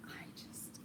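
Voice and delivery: hushed whispered aside. Real, not acted. Gushing to viewers about a cookbook, whispered. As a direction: drop to a breath of a whisper.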